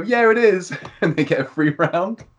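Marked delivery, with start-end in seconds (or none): 0.0-0.7 high, eager confirmation; imitated excited lad; retold gleeful reply. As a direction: answer brightly, pitched way up. Retells lads' gleeful reply.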